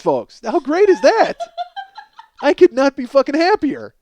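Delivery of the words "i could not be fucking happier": ordinary talk, nothing marked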